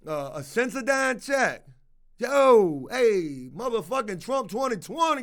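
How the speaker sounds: playful prisoner voice buzzing about a check; excited prisoner. Retold or imitated. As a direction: hype up the rumor like a prisoner. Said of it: "Prisoners probably in that bitch talking about".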